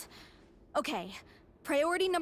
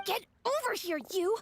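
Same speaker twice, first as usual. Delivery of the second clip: raised-pitch, urgent telling-off; exasperated scolding; 7 semitones higher than usual. Acted. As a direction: scold an oblivious companion. Scolds companion for missing the hint.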